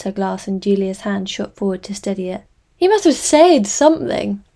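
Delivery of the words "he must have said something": high, loud eager pleading; excited. A narrator's reading. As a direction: press her, high and loud. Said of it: "her voice loud with enthusiasm".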